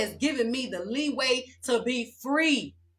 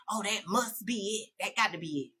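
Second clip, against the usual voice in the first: mock naive viewer; 3 semitones lower, 7 dB quieter than usual. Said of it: "you think".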